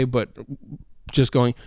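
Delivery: ordinary talk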